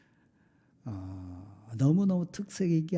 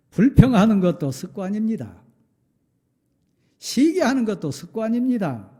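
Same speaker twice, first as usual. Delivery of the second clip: loud, high punch on 불평하는 and 시기하는; emphatic preaching; 6 semitones higher, 9 dB louder than usual. Acted. Pastor warning the church about habits, loud emphatic. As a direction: hammer each habit home.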